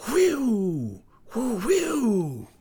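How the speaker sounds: high, falling wind-like whoop; long, shrieking "whee-oo"; old snow woman's howl. A narrator's reading. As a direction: howl like a winter gale. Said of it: "came the old snow woman's voice".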